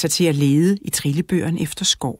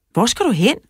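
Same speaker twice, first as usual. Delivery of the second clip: higher call after a friend; 7 semitones higher than usual. A narrator's reading. Mikkel calling after Tobias on his bike, higher call. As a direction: shout across the street. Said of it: "råbte Mikkel".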